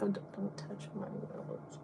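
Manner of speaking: to the lady pointing at her face, hushed protest. Retold or imitated. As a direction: whisper the plea to stop. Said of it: "I was like".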